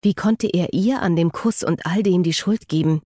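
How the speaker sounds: ordinary delivery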